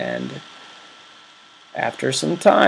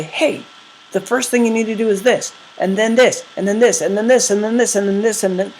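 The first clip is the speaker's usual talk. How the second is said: higher, quick, listing excitedly; eager brain voice; 7 semitones higher, faster than usual. Retold or imitated. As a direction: rattle off the steps excitedly. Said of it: "It'll say".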